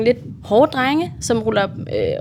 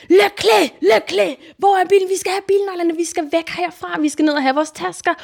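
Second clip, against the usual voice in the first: panicked shouting; 7 semitones higher than usual. Retold or imitated. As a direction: yell in a panic to get out now. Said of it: "sådan råber bare".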